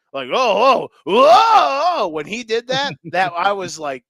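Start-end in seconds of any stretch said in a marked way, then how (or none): 0.3-2.1 loud, high imitated moans of awe; swelling, drawn-out whoa, hammed up; broadcast-booth moaning. Imitating announcers after a trick play, loud mock moaning. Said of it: "they're moaning in the broadcast booth".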